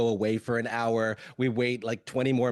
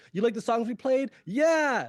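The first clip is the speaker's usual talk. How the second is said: mock call and cheer; 8 semitones higher than usual. Band question, crowd reply.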